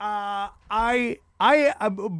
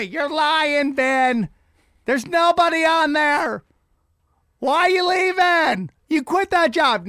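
higher, louder mock nagging wife; scolding wife; 6 semitones higher, 4 dB louder, slower than usual. Wife catches husband faking a call, scolding. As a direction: call out the lie, exasperated.

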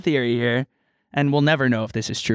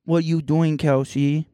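put-on baby talk; slower than usual. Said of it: "Why are you talking like this?".

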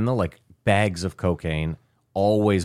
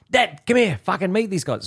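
Aussie calling his dad; 11 semitones higher than usual. Retold or imitated. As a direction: shout for dad over the noise, Aussie style. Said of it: "So he's clearly Australian".

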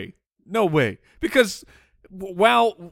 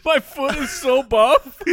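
slow, higher voice cracking with laughter; laughing mock brag; 7 semitones higher, slower than usual. Co-host joking about his buff foot, laughing brag.

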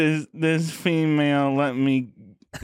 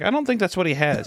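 high schoolgirl; 7 semitones higher than usual. Retold or imitated. Sarah answering Walter.

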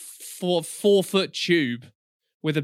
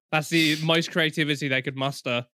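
usual speaking voice

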